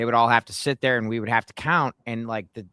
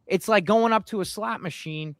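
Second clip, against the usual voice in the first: getting emotional; 4 semitones higher than usual. Real, not acted. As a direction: voice climbing with real frustration. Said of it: "you know I get emotional about this shit".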